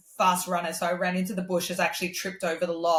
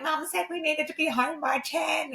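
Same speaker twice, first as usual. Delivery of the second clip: higher-pitched mock nagging; retold childhood squabble, pitched up high; imitating a sibling argument; 10 semitones higher than usual. Girl reminding her brother of mum's curfew, mock nagging. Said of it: "had actually had a fight".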